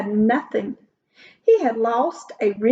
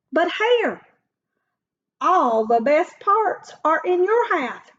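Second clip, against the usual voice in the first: complaining Bear; 6 semitones higher than usual. Acted. Bear complains about pile.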